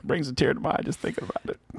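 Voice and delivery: breathy, fast, hushed Clinton voice; stoned whisper. Shown as a demonstration. Showing the co-host how Clinton talks, hushed stoned whisper. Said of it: "talk like this".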